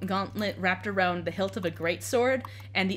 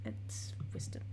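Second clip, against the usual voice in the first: whispered aside; breathy unvoiced whisper; 14 dB quieter than usual. Real, not acted. GM whispers skill roll aside.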